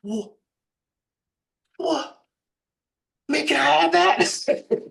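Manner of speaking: very high, stunned friend imitation; stammer, then draw it out loud and high, blown away; mock astonishment. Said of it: "it totally just blew his out of water".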